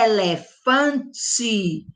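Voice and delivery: "elefante" said very slowly, each syllable clear. Shown as a demonstration. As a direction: spell out "elefante" by sound. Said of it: "ela tem esse som".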